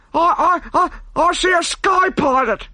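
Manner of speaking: very high, stammering in terror; high panicked stutter; terrified stammer. A narrator's reading. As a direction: stutter out the sighting in fright. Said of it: "stammered the man aloft".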